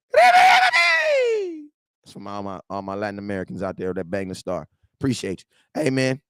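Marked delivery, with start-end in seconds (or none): 0.1-4.3 shout-out. Real, not acted. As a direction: holler it across the room. Shout-out to Latin fans.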